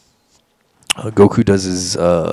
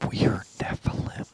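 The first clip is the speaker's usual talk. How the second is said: eerie whispered alien chorus; scary alien voice; 10 dB quieter than usual. Retold or imitated. A man retelling the aliens at his bed, eerie whispered voice. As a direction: breathe it like a ghostly alien hive. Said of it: "they said in, like, scary, alien, high-pitched, deep voice".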